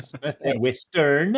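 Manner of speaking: Western punched up and stretched out; stress on Western. Acted. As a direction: lean playfully on the new word Western. Repeats the joke correction.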